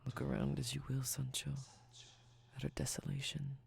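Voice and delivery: soft, intimate, fading to a whisper; sultry close-mic voice. Acted. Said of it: "my sultry speaking voice".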